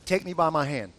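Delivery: low, measured reassurance; calm coaxing. Retold or imitated. Husband to scared wife, calm coaxing.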